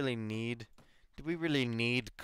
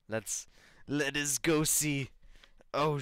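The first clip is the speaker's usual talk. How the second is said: normal talking voice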